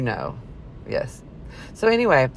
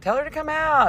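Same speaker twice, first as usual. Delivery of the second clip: quoting him, pitched way up; high mock guy voice; 13 semitones higher than usual. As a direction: jump up high for his line. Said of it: "So he was like".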